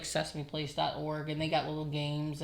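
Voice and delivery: ordinary talk, nothing marked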